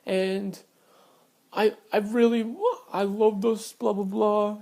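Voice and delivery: retold weepy protest, half-hearted; imitated crying. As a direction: lightly imitate her tearful excuses. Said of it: "She's crying. She's like".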